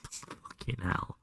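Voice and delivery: breathless whispered curse; under the breath, amazed; hushed disbelief. Real, not acted. Whispered after laughing.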